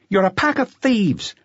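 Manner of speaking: high, slow sneering youth; angry accusation. Acted. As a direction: spit the accusation out.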